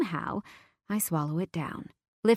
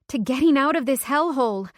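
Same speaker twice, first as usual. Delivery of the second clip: excited toast; 6 semitones higher, 5 dB louder than usual. Acted. Drunken toast.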